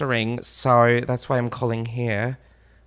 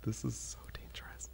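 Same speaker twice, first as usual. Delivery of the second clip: hushed whisper aside; real nervous whisper; 5 semitones lower, 26 dB quieter than usual. Real, not acted. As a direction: whisper so the caller won't hear. Quiet aside during prank call.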